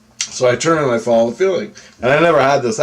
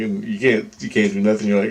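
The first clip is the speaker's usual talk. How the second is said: usual speaking voice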